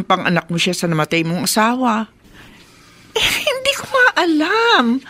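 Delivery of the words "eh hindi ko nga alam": helpless worry. Acted. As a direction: fret helplessly. Helpless worried mother. (nag-aalalang walang magawa)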